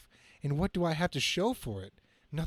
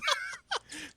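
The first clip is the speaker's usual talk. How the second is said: squealing high giggle; a shrill burst of giggling; high laugh. Acted. Reading Aunt May's line, squealing with laughter.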